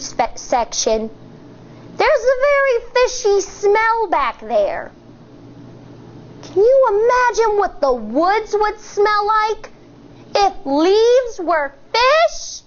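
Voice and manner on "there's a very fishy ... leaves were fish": grossed-out wonder. Acted. Imagining fishy-smelling woods for kids.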